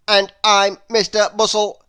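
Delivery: Mister Bustle chiming in, loud and brisk; brisk bustling man. A narrator's reading. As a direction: chime in eagerly and loudly. Second busy man introduces himself.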